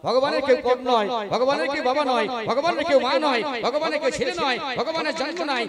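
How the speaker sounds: insistent preacher's litany; preaching litany. Real, not acted. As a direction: drive each repeated phrase up to a loud peak.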